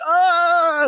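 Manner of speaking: high, loud mock scream; sudden sustained high yelp, acted out; imitated scream. Host imitates guy whose lips get grabbed, high mock scream. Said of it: "and then it's like".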